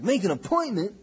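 mimicked indignant query, voice jumping up; mock incredulous echo. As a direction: imitate someone balking at the very idea. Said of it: "It's like".

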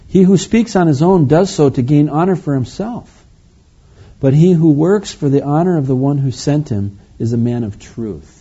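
high, loud proclamation easing down at the close; scripture reading. Acted. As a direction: proclaim the verse firmly, dropping on the last word. Reads scripture to the congregation.

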